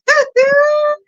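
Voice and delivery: a very high, loud squeal of laughter. Real, not acted. Vlogger overcome at the memory, a very high squealing laugh. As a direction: let out a shrill giggle.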